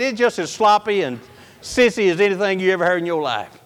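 normal talking voice

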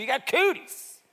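a hint of a high, loud schoolyard jeer; kid's taunt. Mimicking kids taunting a classmate, light mock jeer. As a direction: toss it off like a child teasing. Kids' playground taunt retold.

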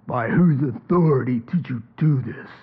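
mock the priest's cold demand; lightly voiced menacing priest; Caiaphas's menacing voice. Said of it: "says in that voice of his".

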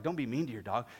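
ordinary talk

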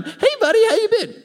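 hammed-up breezy greeting; fake cheery buddy. Mock cheery returning friend.

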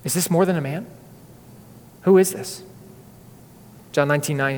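ordinary talk, nothing marked

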